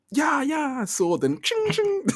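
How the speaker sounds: high-pitched mouth sound effects of swords clashing; sword clang sound effects. Retold or imitated. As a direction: go "ching, ching" high like clashing blades. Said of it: "They're my sound effects".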